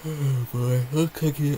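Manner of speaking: real yawn stretching the words. Real, not acted. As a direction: talk right through a yawn. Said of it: "I'm yawning".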